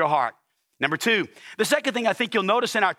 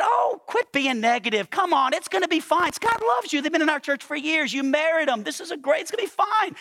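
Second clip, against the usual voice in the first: higher-pitched imitation of a reassuring wife; wife's upbeat scolding; 9 semitones higher than usual. Wife telling worried pastor it's gonna be fine, upbeat scolding. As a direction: pitch climbing as you brush the worry aside. Wife brushes off husband's worry.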